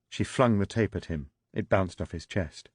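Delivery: normal talking voice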